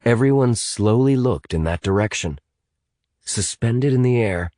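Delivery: plain reading voice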